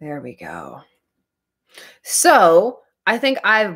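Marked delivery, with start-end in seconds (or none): 0.0-0.8 whispering. Real, not acted. Whispered aside after handling book.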